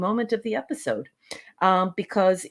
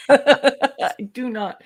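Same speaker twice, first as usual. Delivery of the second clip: slow, louder, laughing objection; laughing denial; 5 semitones higher, 5 dB louder, much slower than usual. Real, not acted. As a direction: protest through laughter. Laughing denial after teasing.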